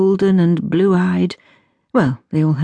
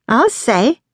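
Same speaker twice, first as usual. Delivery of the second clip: high, pointed reply; quick sting of agreement; sharp answer; 6 semitones higher than usual. A narrator's reading. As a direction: answer your sister sharply. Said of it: "Dulcie answered sharply".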